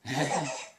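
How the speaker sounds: a playful put-on cough; fake cough for baby. Shown as a demonstration. Dad fakes a cough to amuse his laughing baby, playful cough. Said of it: "Daddy's gonna cough one more time".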